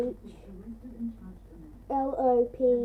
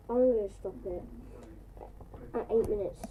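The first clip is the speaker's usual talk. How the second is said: usual speaking voice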